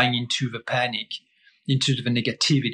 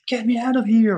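high, louder whiny tantrum cry; a hint of a child's meltdown; mock tantrum; 10 semitones higher, 4 dB louder than usual. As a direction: play the panicking animal brain begging to escape. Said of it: "throw a tantrum and be like".

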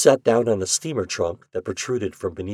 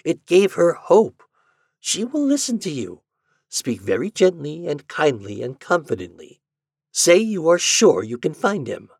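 high-pitched, earnest urging; insisting; 7 semitones higher, 3 dB louder than usual. A narrator's reading. Doctor urging Ford to help the widow, insisting. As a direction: press the point, pitch well up. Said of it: "insisted the doctor".

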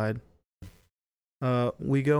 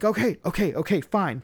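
higher-pitched, exasperated giving in; retold hurried placating; 7 semitones higher than usual. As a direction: give in quickly, as if calming a tantrum. Retold placating of wailing girlfriend.

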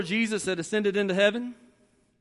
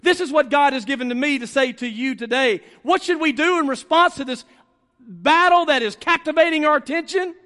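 raised, emphatic sermon delivery; forceful preaching; 5 semitones higher, 5 dB louder than usual. Real, not acted. Preacher challenging the congregation about the battle, forceful preaching.

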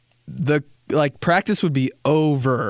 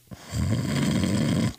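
low mock groaning laugh; imitated sister noise; 6 semitones lower than usual. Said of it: "that's exactly the noise they'd make".

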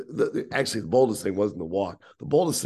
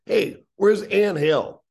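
loud, higher mock holler, stretched out; calling out, retold; 8 semitones higher, 6 dB louder, much slower than usual. Team owner hunting for the young walker, calling out. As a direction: imitate a boss hollering across a crowded room. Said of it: "And he goes".

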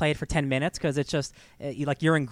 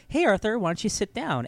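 cheery Mary Beth; 7 semitones higher than usual. Retold or imitated. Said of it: "and she's like".

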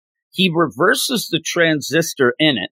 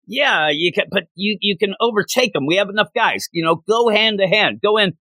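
higher, urgent hammed-up commands; mock frantic orders; 7 semitones higher than usual. Wang Chu rallying his men, frantic higher orders. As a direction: push the troops forward in panic.

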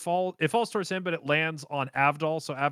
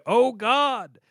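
raised, hammed-up cry; mock horror; 7 semitones higher, 4 dB louder than usual. Joseph told there is no escape, mock horror. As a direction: imitate a cartoon cry of dread.

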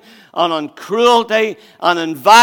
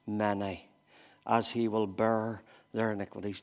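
hushed solemn reading; 7 semitones lower, 7 dB quieter than usual. Acted. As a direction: reread it as if it hurts. Rereads verse, slower and softer.

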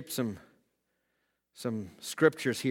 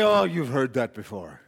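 the devil's voice. Retold or imitated. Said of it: "And the devil will say".

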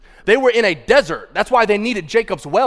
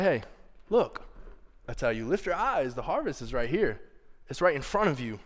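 soft, lowered, tender voice; Jesus, gentle; 3 semitones lower, 9 dB quieter than usual. Retold or imitated. Jesus gently showing the disciples the harvest, soft and tender. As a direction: coax quietly, without any aggression. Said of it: "Look how gentle he was with the disciples".

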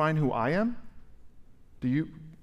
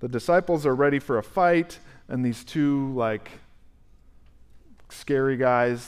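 normal talking voice

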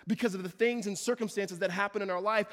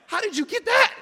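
much higher, much louder amazed question; astonished; 12 dB louder than usual. Retold or imitated. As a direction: shout it in disbelief. Amazed at parent decoding.

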